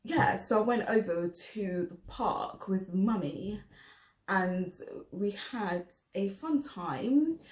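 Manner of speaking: measured, carefully spoken posh voice; posh accent. Shown as a demonstration. Mum recalling the park with Mummy, posh accent. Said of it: "posh, yeah. So it's like".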